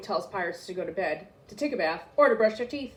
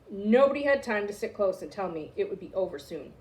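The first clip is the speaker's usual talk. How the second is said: ordinary delivery, nothing marked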